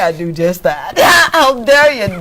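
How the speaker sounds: real crying breaking into a laughing mock scold; real sobbing laugh. Real, not acted. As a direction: let the tears come and laugh through them. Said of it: "Every time I say I'm not going to cry".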